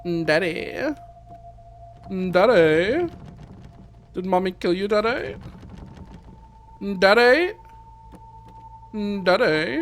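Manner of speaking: put-on a scared child calling for her daddy. Streamer voices a child calling her dead father, high and rising.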